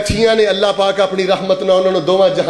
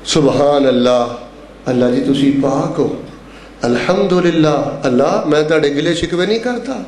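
low-pitched, prayerful phrases; subdued dhikr with pauses; devotional recitation; 6 semitones lower than usual. Real, not acted. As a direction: speak to God in a low pitch.

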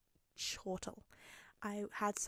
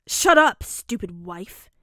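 flustered shout; 16 dB louder, slower than usual. Acted. Bakugo silencing a teasing Midoriya, shouting. Bakugo snaps at being teased.

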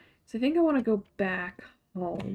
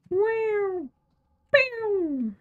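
high, drawn-out mock sound effect; playful imitated noise; 12 semitones higher than usual. Gamer making noises while playing, playful high swoops.

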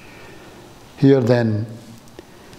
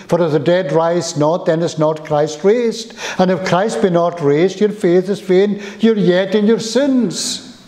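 preaching at full force; 4 semitones higher than usual. Acted. Preacher reading Corinthians to the congregation, rising fervour. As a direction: build the verses up to a fervent peak.

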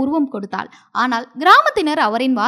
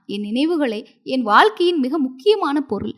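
ordinary delivery

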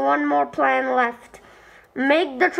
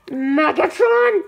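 usual speaking voice

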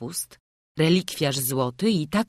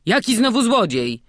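louder, higher indignant retort; offended grumble; 7 semitones higher, 8 dB louder than usual. A narrator's reading. Offended night watchman answers the crowd, indignant grumble. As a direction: snap back, affronted. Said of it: "burknął Mikołaj, obrażony".

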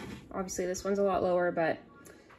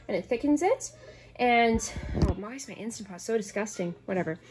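normal talking voice

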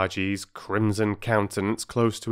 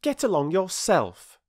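a high, defiant schoolboy retort; lightly voiced boy, talking back; cheeky boy; 11 semitones higher than usual. A narrator's reading. Peter talking back to the bargee, high and cheeky. Boy's voice.

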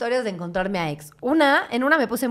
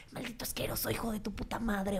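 hushed curse; 15 dB quieter than usual. Retold or imitated. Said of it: "le mandé mensajes".

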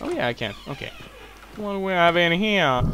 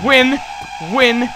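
loud, high victory shouts; exultant chanting, easing slightly; triumphant cheer; 5 semitones higher, 6 dB louder than usual. Real, not acted. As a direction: crow over a win in short bursts. Cheers at beating the monster.

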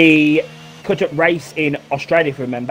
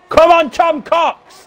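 excited cheer; 6 dB louder, much slower than usual. Real, not acted. Cheering on a driver.

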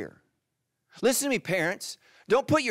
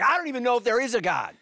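fast, higher, laughing doubter voice; mock doubter; 7 semitones higher, 3 dB louder, faster than usual. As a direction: toss it off like a lost believer, half laughing.

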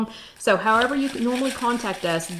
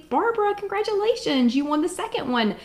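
much higher, beaming congratulations; excited congratulations; 9 semitones higher than usual. Real, not acted. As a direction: cheer the winner's name. Congratulating giveaway winner.

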